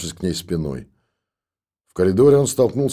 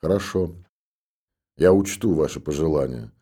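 coldly; 3 semitones lower than usual. A narrator's reading. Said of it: "холодно сказал старик".

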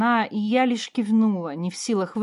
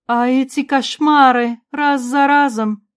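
high, slow, distressed mother; acted anguish, pitched up and drawn out; tearful murmur; 5 semitones higher, 8 dB louder, slower than usual. A narrator's reading. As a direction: play her close to a sob, but fully voiced. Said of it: "пробормотала Ванора и всхлипнула".

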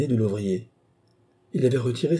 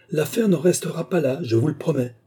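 raised-pitch threat; trembling indignation; 7 semitones higher than usual. A narrator's reading. Billy warns the worker it won't end there, agitated. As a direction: promise consequences, agitated. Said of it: "dit Billy d'une voix tremblante".

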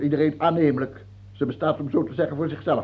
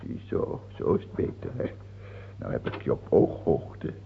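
soft breathy whisper; mock tender whisper; 11 semitones lower, 6 dB quieter than usual. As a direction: lean close to the cage. King whispers to caged man. (zacht fluisterend)

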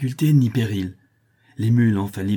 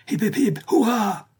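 full-throated cheer; 13 semitones higher than usual. A narrator's reading. The men cheer as they ride off, full-throated. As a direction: cheer at the top of your lungs. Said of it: "crient à pleine gorge les hommes".

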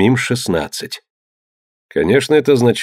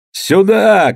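much higher, loud call; shouting; 9 semitones higher, 4 dB louder than usual. A narrator's reading. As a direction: call the men over. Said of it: "крикнул Тьор".

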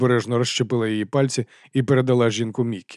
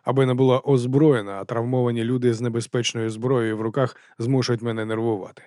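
usual speaking voice

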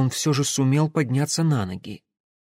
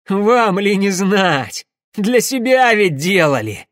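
high, loud, drawn-out outburst; half-crazed jeer at the enemy; hysterical taunt; 7 semitones higher, 7 dB louder, slower than usual. A narrator's reading. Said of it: "истерически хохотнул Пахомов". (истерический выкрик)

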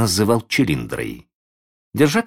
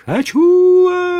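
put-on shrill high wail of a spoiled boy; screaming tantrum child; 7 dB louder, slower than usual. A narrator's reading. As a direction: scream like a stamping brat. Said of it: "закатывался, топая ногами, кудрявый мальчик".

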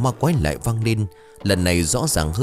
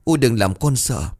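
faint pleading; 6 semitones higher than usual. Acted. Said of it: "rồi thều thào".

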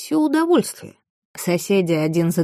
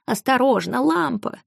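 higher, voice lifted, alarmed cry; high shout; 6 semitones higher than usual. A narrator's reading. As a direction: warn them sharply, high up. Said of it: "крикнула Джина".

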